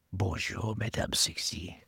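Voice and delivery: whispered sleazy French charmer; Albert Galoche whisper. Pretend. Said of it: "the whole Albert Galoche".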